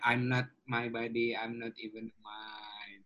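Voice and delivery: slow, soft chanted affirmation; meditative mantra. Shown as a demonstration. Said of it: "And Isya Kriya".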